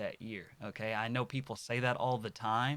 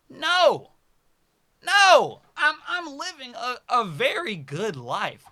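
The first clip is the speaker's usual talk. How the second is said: much higher, much louder no; emphatic shout; 13 semitones higher, 14 dB louder, slower than usual. Real, not acted. Rejecting the idea of a horrible life, emphatic shout. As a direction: shout it down with conviction.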